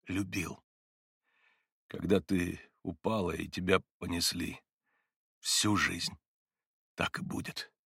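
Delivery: hushed, halting phrases with long breaks; catching breath. A narrator's reading. Mitya vows lifelong love to Katya, breath failing. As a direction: stop for breath after every few words. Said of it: "переводя дух на каждом слове, заговорил и Митя".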